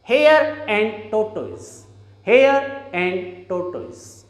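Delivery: "hare and" slowly with the R of "hare" linked into "and"; slow linking demo. Shown as a demonstration. Teacher slowly modelling a story title with linking R. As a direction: say "hare and tortoise" slowly, R carried into "and". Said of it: "pronounce this title of a story as".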